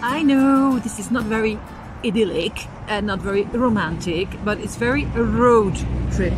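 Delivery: normal talking voice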